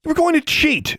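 high furious yell. A narrator's reading. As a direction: yell it, outraged. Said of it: "she yelled".

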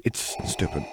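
soft, dismissive mutter. A narrator's reading. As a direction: mutter it off, dropping the volume. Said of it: "he muttered".

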